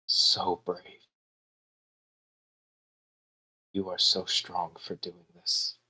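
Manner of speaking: kneel and comfort her softly; tender comfort. Acted. Brother kneels to comfort his little sister, softly.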